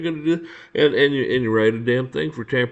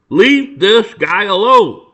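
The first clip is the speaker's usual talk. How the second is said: loud, higher, slow drawn-out command; emphatic mock order; 7 semitones higher, 9 dB louder, much slower than usual. Bosses ordering officers to back off, emphatic mock order. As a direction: call it out forcefully, word by word.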